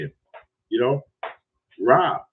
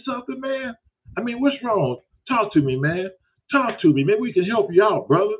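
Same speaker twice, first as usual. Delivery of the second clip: higher, mock-incredulous scolding of the caller; Daryl scolding; 7 semitones higher than usual. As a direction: play Daryl tearing into him.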